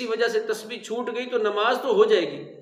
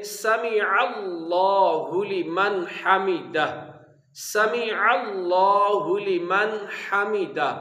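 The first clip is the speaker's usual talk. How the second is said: slow recitation; much slower than usual. Shown as a demonstration. Reciting the prayer phrase.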